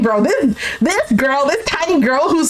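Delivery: ordinary talk, nothing marked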